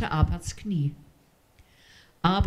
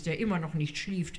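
plain reading voice

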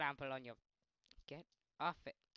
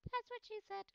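very high squeaky voice; squeaky joke; 5 dB louder than usual. Acted. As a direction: squeak the punchline.

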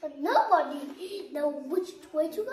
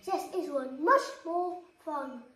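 ordinary delivery

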